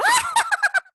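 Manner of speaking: high cackling witch laugh. Real, not acted. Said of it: "Oh, my witch laugh?".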